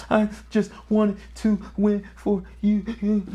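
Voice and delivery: high, tight crying plea, mildly voiced; a son in tears pleading his case; retold crying plea. Crying son tells his dad he wanted to win for him. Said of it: "frantically crying".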